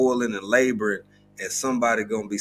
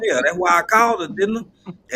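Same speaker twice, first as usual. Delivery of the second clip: higher, louder sarcastic grumble; exasperated; 7 semitones higher, 4 dB louder than usual. Retold or imitated. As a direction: roll your eyes at the machine. Arguing with automated phone line.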